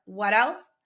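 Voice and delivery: usual speaking voice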